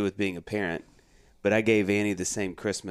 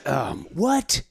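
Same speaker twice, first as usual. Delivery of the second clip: mock shocked surprise; 8 semitones higher, much slower than usual. Feigned surprise at son.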